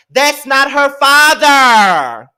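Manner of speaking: loud and slow, leaning on every word; emphatic drawn-out outrage. Real, not acted. Scolding Portia about Simon acting as PJ's father, loud drawn-out outrage. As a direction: spell it out, indignant and drawn out.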